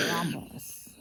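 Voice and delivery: ordinary delivery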